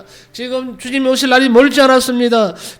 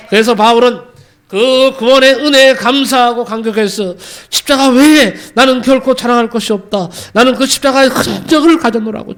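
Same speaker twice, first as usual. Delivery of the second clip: louder, impassioned sermon peak; fervent preaching; 2 semitones higher, 6 dB louder than usual. Acted. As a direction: drive the point home to the congregation. Preaching climax. (뜨겁게 외치는 설교)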